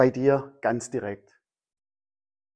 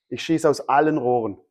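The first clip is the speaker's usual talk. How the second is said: ordinary talk, nothing marked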